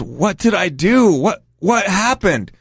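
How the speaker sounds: high, slow, dazed plea; imitated tearful bewilderment. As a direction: plead like a hurt, confused victim. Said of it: "tears coming out of his eyes".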